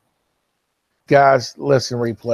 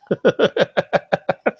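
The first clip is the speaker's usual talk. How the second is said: high staccato giggle, loud; real laugh; 9 semitones higher, 5 dB louder than usual. Real, not acted. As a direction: burst into a high, rapid string of chuckles. Amused by a chat comment.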